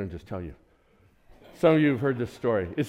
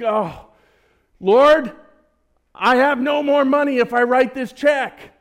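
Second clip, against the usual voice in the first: high, loud mock-desperate plea; panicked prayer; 10 semitones higher, 8 dB louder, slower than usual. Preacher voicing his younger self crying to God, high and desperate. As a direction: wail it like a broke student begging God.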